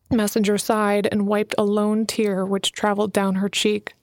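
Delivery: ordinary delivery, nothing marked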